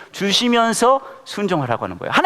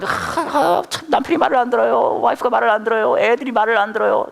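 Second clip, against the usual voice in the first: mock complaining in a higher voice; 6 semitones higher than usual. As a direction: act out a congregant griping about the family. 청중의 가족 불평을 흉내. (높은 목소리로 하소연하는 흉내)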